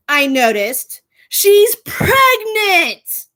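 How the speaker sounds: slow gossip bombshell; dramatic reveal. Real, not acted. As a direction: drag it out for the big reveal. Dramatic reveal of her suspicion.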